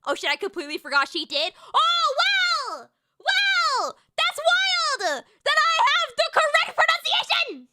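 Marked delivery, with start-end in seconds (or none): 1.8-7.7 very high, sarcastic yell; angry outburst. Real, not acted. Correcting others on pronunciation, angry high-pitched outburst. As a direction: wail it in outrage, pitched way up. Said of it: "I'm so angry".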